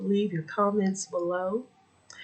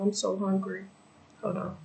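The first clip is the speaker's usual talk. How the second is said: ordinary delivery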